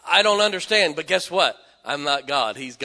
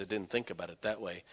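tearful softness; 8 semitones lower, 13 dB quieter than usual. Retold or imitated. Woman in tears.

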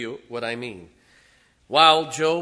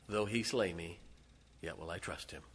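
quiet resolve; 7 semitones lower, 11 dB quieter than usual. Acted. Job's faith after final news.

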